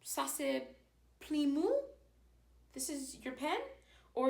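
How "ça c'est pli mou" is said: rising at the end on "mou" to make it a question; question by intonation. Shown as a demonstration. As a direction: let "pli mou?" lift up like a question. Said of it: "by the way that you say it with your voice".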